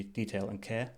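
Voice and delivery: normal talking voice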